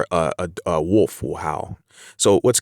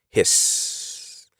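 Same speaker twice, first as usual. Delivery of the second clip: hissed word. Shown as a demonstration. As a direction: stretch the S at the end into a hiss. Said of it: "as you can kinda hear how I'm saying that".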